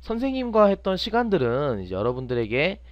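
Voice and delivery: ordinary talk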